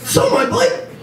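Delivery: shrieking high squeal of disbelief; shocked disbelief. Retold or imitated. Boyfriend asked to sell his Harley, high shocked squeal. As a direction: squeak out the outrage. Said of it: "And I'm thinking".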